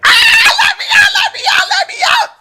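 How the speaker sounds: high, loud, fast screaming; desperate shout. Retold or imitated. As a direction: shriek to be let out. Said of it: "my spirit be like".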